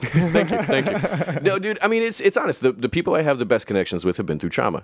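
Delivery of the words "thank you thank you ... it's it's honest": laughing thanks. Real, not acted. Touched and laughing at joke.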